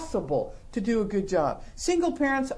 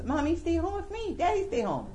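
lightly voiced pleading toddler, a bit higher; a hint of a little kid begging; 7 semitones higher than usual. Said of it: "The two-year-old votes".

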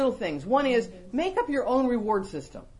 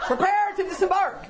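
higher mock captain's order; mock command; 10 semitones higher, 3 dB louder than usual. Repeating the catchphrase to her friend, hammed-up order. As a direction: announce it like a ship's officer, laughing. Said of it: "That's my phrase".

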